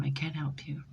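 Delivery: breathy whispered aside; soft unvoiced admission. Real, not acted. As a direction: whisper it apologetically. Quiet aside.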